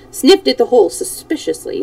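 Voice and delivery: ordinary delivery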